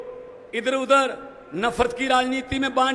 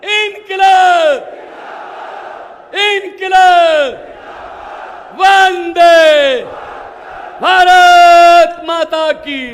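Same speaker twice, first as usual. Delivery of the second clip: long, loud rally chant, higher; slogan shout; 4 semitones higher, 8 dB louder, much slower than usual. Real, not acted. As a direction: lead the crowd chant at full volume. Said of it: "थोड़ा और". (भीड़ से ज़ोरदार नारे लगवाना)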